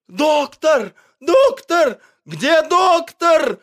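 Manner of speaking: high, loud frantic calling; animals shouting. A narrator's reading. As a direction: scream his name into the burning house. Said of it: "кричали на улице звери".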